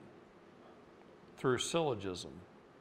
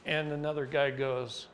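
normal talking voice

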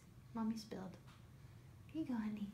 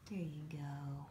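whispering; 6 semitones lower, 9 dB quieter than usual. Real, not acted. Soothing baby quietly.

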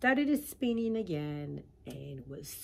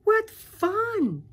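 much higher, louder squeal of joy; happy pollywog; 13 dB louder than usual. Acted. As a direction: burst out delighted like a child. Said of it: "the pollywog said very happily".

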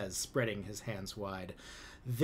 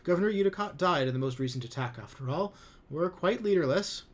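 higher, louder, hurried appeasing; nervous placating; 8 semitones higher, 7 dB louder than usual. A narrator's reading. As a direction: talk fast to calm him down. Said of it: "they stammer".

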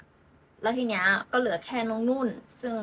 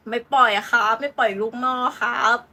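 louder, mock-official refusal; Newcastle refusing firmly; 5 dB louder than usual. Newcastle answers bidders for its homegrown striker, firm refusal. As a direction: play the club lightly, putting its foot down. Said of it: "แต่ว่าทางนิวคาสเซิล".

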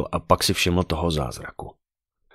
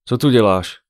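loud, higher-pitched snapped question; irritated outburst, raised voice; 6 semitones higher, 7 dB louder than usual. A narrator's reading. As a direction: spit the question out at him. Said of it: "vyprskla na něho".

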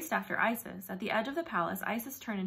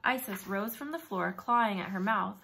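plain reading voice

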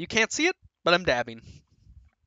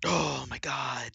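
slow whispered awe; awed whisper; 2 semitones lower, 6 dB quieter, slower than usual. Real, not acted. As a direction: breathe it out in disbelief. Awed after finishing.

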